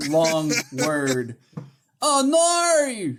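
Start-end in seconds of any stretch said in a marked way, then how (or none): 2.0-3.1 drawn-out, high Australian "Oh, no"; Australian accent. Acted. Mocking the word no in Australia, exaggerated Australian accent. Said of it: "how many letters are in the word no in Australia".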